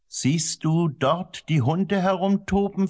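high, slow storybook question; asking. A narrator's reading. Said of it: "fragte er".

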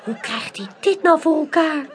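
ordinary delivery, nothing marked

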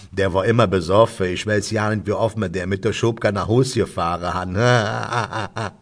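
put-on old Kölsch pub regular; amused old undertaker. Undertaker shares an unasked anecdote, amused dialect voice. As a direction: tell it in thick dialect as an amused old local. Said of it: "spuckt erheitert aus".

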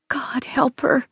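soft, anxious whisper; hushed, pleading whisper; whispered prayer. A narrator's reading. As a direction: pray under your breath. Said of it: "Catherine prayed".

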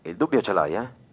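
quiet taunt. Acted. Caller needles the host about the bomb, quiet and menacing.

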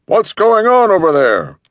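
high, loud shout from afar; man shouting. A narrator's reading. Man with the pit bull shouts across at the noise, loud. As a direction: yell across the lot to find out what's wrong. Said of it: "A deep male voice shouting".

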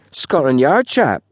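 high, slow and self-important. A narrator's reading. Young porter tells his boss of police, importantly. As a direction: announce it like privileged news. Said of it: "said Wally importantly".

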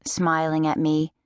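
plain reading voice

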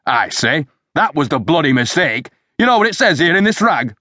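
high-pitched, indignant tirade; angry rant. A narrator's reading. Superintendent berates his men over the newspaper, ranting angrily. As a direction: rant in exasperation, voice pitched well up. Furious boss rants at subordinates.

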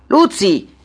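sharp summons by name; calling someone back. A narrator's reading. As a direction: call out to the departing secretary. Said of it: "lo richiamò".

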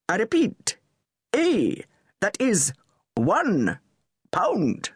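put-on public school accent, withering sarcasm; sarcastic. A narrator's reading. Anwar mocking Charles in a public school accent, sarcastic. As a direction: spell each word out with cutting patience. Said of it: "Anwar's sarcasm".

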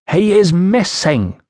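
put-on high, slow "missing" with foreign vowels, Iceni accent. A narrator's reading. Woman reports her husband to the official, Iceni accent. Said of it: "trouble following your accent".

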